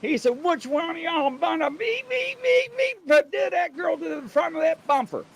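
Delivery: very high sarcastic chief voice; imitating the angry chief; mock sarcastic chief. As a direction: echo his woo with scorn. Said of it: "He's like".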